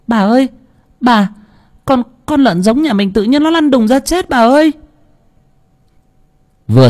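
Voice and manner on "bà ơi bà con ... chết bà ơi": high, frantic servant's cry; panicked. Acted. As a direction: blurt it out in alarm. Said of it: "miệng hốt hoảng". (hoảng hốt kêu lên thất thanh)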